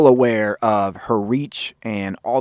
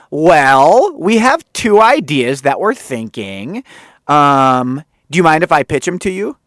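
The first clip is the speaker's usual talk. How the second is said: louder, bright pitch voice; mock perky producer pitch, a touch higher and louder; upbeat Benji; 4 semitones higher, 6 dB louder than usual. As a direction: sell the idea cheerfully. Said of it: "And he's like".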